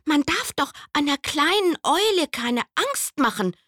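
hoch und laut, empört; schimpfend. Acted. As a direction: die Tiere schimpfen den Käfer aus. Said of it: "schimpften die Tiere". (laut empörtes Schimpfen)

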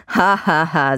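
dry mock laugh, slow on the "witzig"; sarcastic fake laugh. Mocking a partner's joke.

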